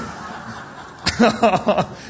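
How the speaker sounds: unvoiced wheezing chuckle; breathy chuckle. Preacher showing how Abraham chuckles, a breathy chuckle. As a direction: chuckle under your breath, tickled. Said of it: "He starts chuckling".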